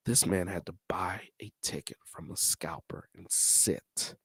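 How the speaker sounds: soft whisper, almost no voice; hushed whisper. Real, not acted. Vlogger telling how Jamerson bought a scalped ticket, whispering.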